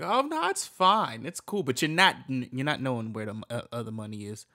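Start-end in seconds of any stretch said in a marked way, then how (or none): 0.0-1.6 mock casual reassurance. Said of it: "just like".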